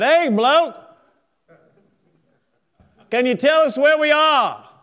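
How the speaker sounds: put-on loud, high drunken hail; drunk shout. Drunk sailor hails a naval officer through the fog, loud and high. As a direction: call out to the stranger like a tipsy sailor.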